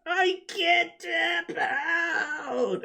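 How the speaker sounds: high, very slow strained cry-shout; desperate cry. Retold or imitated. Goku refusing Beerus, high drawn-out desperate cry. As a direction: wail it out at the end of your strength.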